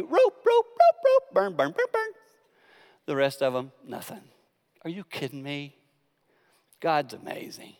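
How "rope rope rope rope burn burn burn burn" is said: mock divine chant; God directing fire. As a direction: pick out each rope to burn. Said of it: "The specific way God said".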